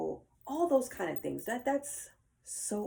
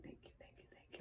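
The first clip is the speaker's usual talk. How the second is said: grateful whisper; 22 dB quieter, much faster than usual. Retold or imitated. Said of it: "I'm just like".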